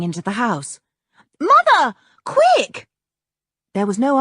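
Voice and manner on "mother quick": urgent shout. Acted. Girl calling mother urgently.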